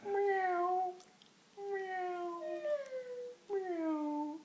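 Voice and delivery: high, drawn-out meows; cat meow. Pretend. Parent playing cat for a child, high long meows. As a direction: mew like a cat on a high note.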